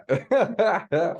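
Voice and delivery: high-pitched amused laugh. Real, not acted. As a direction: laugh along at the story. Amused by guest's Aussie story.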